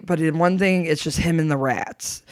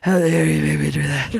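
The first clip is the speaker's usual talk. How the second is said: mock outrage. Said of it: "I was so mad at him".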